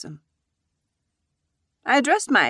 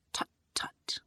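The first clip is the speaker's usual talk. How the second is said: whispered; 11 dB quieter than usual. Acted. Naked man wags his finger.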